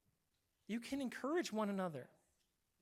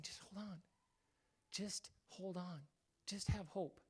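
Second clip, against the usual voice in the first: soft, near-whispered reassurance; gentle whisper of God; 8 dB quieter, slower than usual. Pretend. God comforting a struggling believer, soft whisper. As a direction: breathe it softly, as God comforting.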